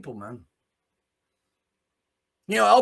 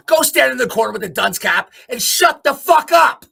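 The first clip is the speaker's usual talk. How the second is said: angry shout; 5 semitones higher, 9 dB louder than usual. Real, not acted. Telling the government to sit down, angry shouting.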